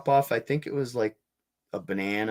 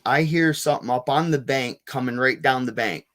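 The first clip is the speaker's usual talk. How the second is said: ordinary talk, nothing marked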